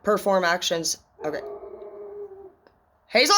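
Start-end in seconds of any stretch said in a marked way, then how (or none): 3.1-3.3 raised voice for the dog; calling the dog. Real, not acted. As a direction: call the dog's name out.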